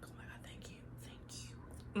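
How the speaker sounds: breathy whispered thanks; relieved whisper. Retold or imitated. Whispering thanks on hearing he's okay. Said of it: "I was just like".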